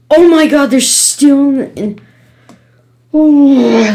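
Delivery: loud, high cry of dismay; dismayed disbelief. Real, not acted. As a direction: burst out in appalled surprise. Discovers another part of level.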